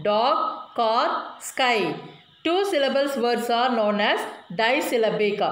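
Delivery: usual speaking voice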